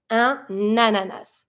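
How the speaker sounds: un ananas, N carried onto the A, slow; slow linked French un ananas. Shown as a demonstration. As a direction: link the N of un straight into ananas. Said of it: "link the N with the A".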